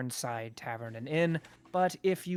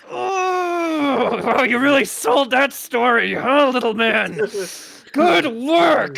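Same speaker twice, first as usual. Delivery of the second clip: higher, louder hammed-up yelp of pain turned praise; mock pained praise; 7 semitones higher, 8 dB louder, slower than usual. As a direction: cry out as if kicked, then praise. Innkeeper hit in shin, acting.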